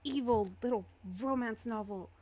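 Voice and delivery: lightly voiced exasperation at a stubborn book; a touch of drawn-out frustration; exasperated Lumiere. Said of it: "And he's like".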